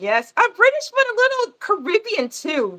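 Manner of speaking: high-pitched British accent with Caribbean lilt. Shown as a demonstration. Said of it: "some of the English accent with a little Caribbean in it".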